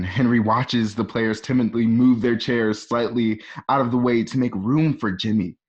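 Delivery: normal talking voice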